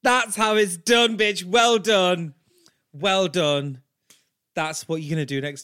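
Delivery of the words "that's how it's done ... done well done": emphatic, triumphant praise; big drawn-out well done; loud congratulation. Real, not acted. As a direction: cheer them on like a coach, punching each word. Triumphant praise after role-play.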